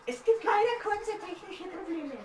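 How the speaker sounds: high mock announcer voice. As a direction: fake broadcast announcement. Mock technical-difficulty announcement.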